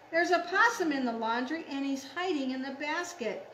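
quick, urgent picture-book alarm; excited story reading. Acted. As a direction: announce the intruder in a hurry.

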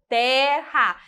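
"terra" as two separate beats; split into syllables. Shown as a demonstration. Teacher dividing words for class, "terra" split in two. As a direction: say "terra" with a break between syllables. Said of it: "com a separação silábica gramatical".

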